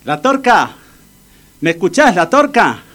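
high, urgent call to rouse someone. Acted. Friend calling to his unconscious pal, calling out. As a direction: call him back, pitch leaping up.

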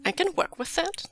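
high, lilting reply with a rise at the end; light agreement. A narrator's reading. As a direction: agree lightly, pitched well up. Character agrees finally, unworried.

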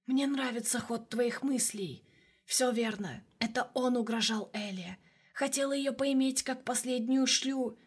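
soft, slow sinister shadow voice; creeping nightmare whisper. A narrator's reading. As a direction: purr it like a tempting demon. The shadow voice speaking.

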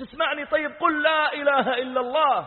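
high, loud, desperate urging; retold frantic demand; urgent shouting. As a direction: shout to get through to a dying man. Pressing unresponsive dying youth.

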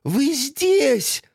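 joyful surprise. Acted. Tosya sees aunts.

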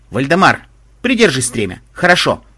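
plain reading voice

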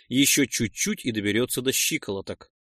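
plain reading voice

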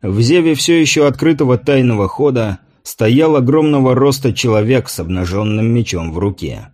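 ordinary delivery, nothing marked

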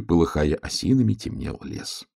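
plain reading voice

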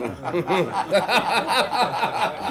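a short knowing laugh at a cynical joke; amused laugh. Acted. Prime minister amused at handing off the job, chuckling. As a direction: chuckle at pushing the dirty job onto him.

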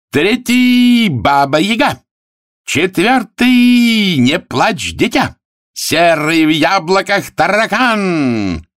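high, slow ringing call; race announcer. Acted. Arthur calls the runners to the crowd, high drawn-out announcing. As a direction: stretch each name out high.

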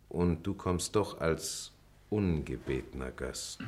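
measured recital with long pauses between lines; deliberate poetry reading, letting each line settle; poem recitation. Acted. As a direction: recite the verse solemnly, line by line. Pupil reads the poem aloud.

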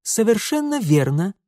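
icy, pointed comeback; cold retort. A narrator's reading. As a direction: rap it out coldly. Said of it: "холодно отчеканил Митя".

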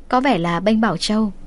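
usual speaking voice

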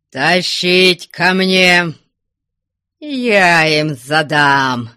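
loud, slow, drawn-out bellow; enraged shout. A narrator's reading. Enraged father orders the runaways dragged in, furious shout. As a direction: roar orders to the whole house. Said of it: "Задыхающимся голосом на весь дом закричал".

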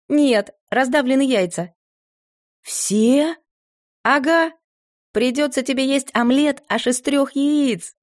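put-on perky teenage daughter; girl's voice. Sashka voiced higher.

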